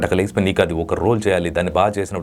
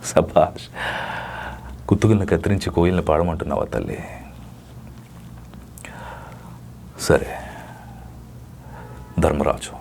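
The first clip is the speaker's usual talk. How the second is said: Karna's lament; 2 semitones lower than usual. Acted. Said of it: "ఒక dialogue చెప్పమంటే".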